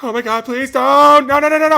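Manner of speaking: hammed-up terror of a fleeing defender; panicked plea. Jaguars player running from Trent Williams, mock panicked shrieking.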